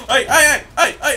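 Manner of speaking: very loud, high hey hey; excited shouting. Real, not acted. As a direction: shout in the heat of the kill. Shooting the enemy.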